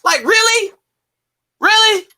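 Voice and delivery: can't believe what you're hearing; disbelief. Real, not acted. Incredulous reaction to a boast.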